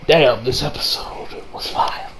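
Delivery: hushed, slow whisper of awe; whispered aside. Real, not acted. Vlogger whispering how good the episode was. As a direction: breathe it like a secret.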